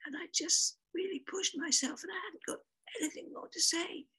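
high husky whisper; lost voice. Retold or imitated. Describing exhaustion and losing voice.

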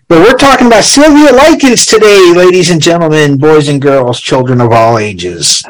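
booming ringmaster announcement. Acted. Circus announcer opening.

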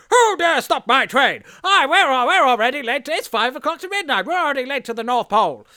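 high, loud, outraged train conductor; Tom Hanks's conductor. Acted. Conductor rushing in over his stopped train, hammed-up outrage. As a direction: bellow in a hammed-up frantic high voice. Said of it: "Tom Hanks rushes in and goes".

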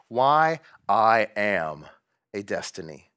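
very slow, weighty; emphatic title. Shown as a demonstration. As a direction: lean on each word of the title. Reading Nietzsche chapter title.

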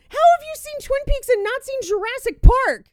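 high, loud, stunned outburst; shocked disbelief. Real, not acted. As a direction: yelp it in total disbelief. Shocked she saw Twin Peaks.

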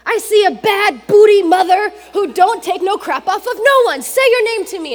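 loud, high, punchy hype man; fired-up hype. Retold or imitated. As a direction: pump him up with every line. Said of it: "So he goes".

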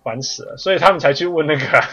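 normal talking voice

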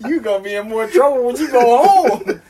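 loud, high words through laughter; laughing quote. Retold or imitated. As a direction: retell the punchline while cracking up.

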